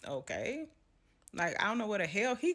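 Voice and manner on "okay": unbothered okay. Retold or imitated. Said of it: "I'm like".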